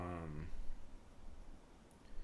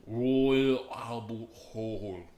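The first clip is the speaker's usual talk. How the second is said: drawn-out, loud British accent; mock British accent; 3 semitones higher, 8 dB louder, much slower than usual. Shown as a demonstration. Streamer showing off a supposedly perfect accent, mock British accent. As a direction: put on a big, stretched British accent. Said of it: "uh, perfect British accent".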